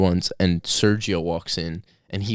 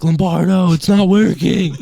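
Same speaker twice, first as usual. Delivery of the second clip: high, louder, whiny complaint; whiny kids; 9 semitones higher, 7 dB louder, slower than usual. Retold or imitated. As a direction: whine it up high like a kid. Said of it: "And kids would be like".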